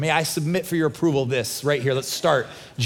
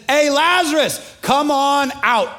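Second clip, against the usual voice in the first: loud call; 7 semitones higher, 3 dB louder, slower than usual. Retold or imitated. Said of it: "And he says".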